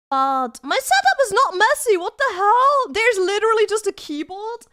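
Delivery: high, loud, offended defence; indignant protest. Real, not acted. Streamer defends the setup to chat, indignant. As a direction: protest loudly, as if wrongly accused.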